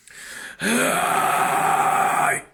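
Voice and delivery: high airy rasp; rasping fry scream. Shown as a demonstration. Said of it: "You can do that from vocal fry".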